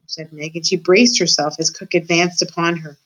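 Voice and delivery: ordinary delivery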